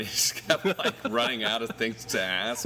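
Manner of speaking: laughing through the words; amused, chuckling as you speak. Real, not acted. Musician telling podcaster about a stoned host running out of questions, laughing.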